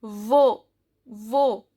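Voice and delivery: "vo" ending on a pure o; "vo" as a careful French model; silent final X. Shown as a demonstration. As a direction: say "vo" low and distinct, no X. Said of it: "X is silent".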